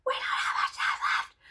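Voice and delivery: breathless cry; whispered despair. Retold or imitated. To her husband, whispered and tearful. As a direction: whisper it like crying. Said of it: "I was like".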